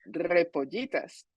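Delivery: "repollitas" with a rolled Spanish R. Shown as a demonstration. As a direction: roll the opening R of "repollitas". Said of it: "Rolling those R's can be".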